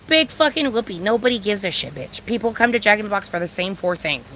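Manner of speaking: normal talking voice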